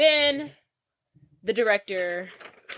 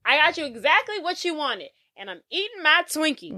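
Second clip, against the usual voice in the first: higher, self-satisfied delivery; mock smug triumph; 7 semitones higher than usual. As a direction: gloat as you hand it over. Publicist's triumphant line acted out.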